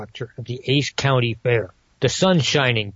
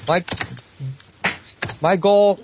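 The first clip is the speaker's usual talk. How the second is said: ordinary talk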